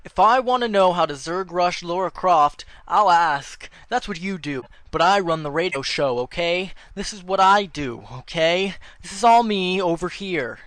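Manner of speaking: loud, high angry outburst; angry rant. Acted. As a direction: yell him down, offended.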